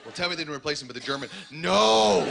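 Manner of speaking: loud mock gasps of disbelief; hammed-up shocked onlookers; mock shocked crowd. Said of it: "a sea of people going".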